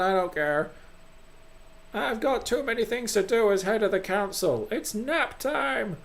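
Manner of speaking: high, theatrical grumbling; cranky council head. Acted. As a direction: start with a very high mock cheer, then grouse.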